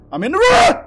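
high, loud yell rising at the end; shouted announcement. Real, not acted. Gamer announcing he is in the room, shouted. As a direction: holler it out, stretching the last word.

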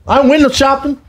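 high, emphatic mimicry; high-pitched mock indignation; shopper's retort imitation.

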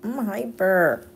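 high, excited puppy voice, loud at first then easing; hyper puppy. Acted. Speaking as the puppy who won't let everyone sleep, hyper. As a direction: voice a dog too wound up for bed. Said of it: "And he's like".

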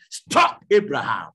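loud commanding shout of "Stop, Abraham"; voice from heaven shouting. Acted. The voice from heaven stopping Abraham, loud commanding shout. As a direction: thunder it down as the voice of heaven. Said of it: "the voice speak from heaven and said".

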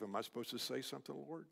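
hushed, quick aside to God; inward prayer. Retold or imitated. Preacher silently asks the Lord for guidance, hushed aside. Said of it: "I just said to myself".

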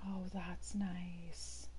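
whispered, very slow and soft; drawn-out unvoiced whisper of admiration. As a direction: breathe "Oh, that's nice." out as a hush. Repeats it, whispered this time.

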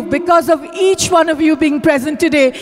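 normal talking voice

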